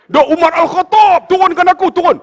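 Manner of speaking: loud, high urgent order to put him down; urgent command. Acted. Muawiyah orders bearers urgently. (arahan kuat dan cemas)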